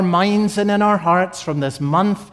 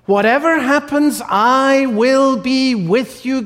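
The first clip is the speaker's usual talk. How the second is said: voicing God's promise; 5 semitones higher, 4 dB louder than usual. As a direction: speak it as God reassuring his people. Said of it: "God says".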